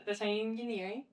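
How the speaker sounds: ordinary delivery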